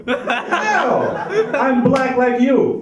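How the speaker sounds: high, loud exasperated insistence; indignant protest. Retold or imitated. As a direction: insist you belong, half laughing. Protesting reply to the stranger.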